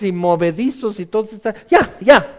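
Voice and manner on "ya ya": put-on restless kid. Fidgety kids voiced.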